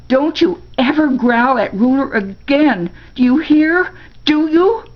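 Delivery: high, loud angry outburst; shouting. A narrator's reading. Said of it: "shouting at Chipper".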